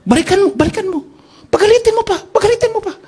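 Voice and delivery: imitating an eager pastor; excited pastor. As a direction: urge him on eagerly. Pastor eagerly urging him back.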